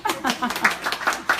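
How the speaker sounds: loud, slow proud announcement; proud shout. Real, not acted. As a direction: call it out to the room, slow and loud. Proud shout to cheering room.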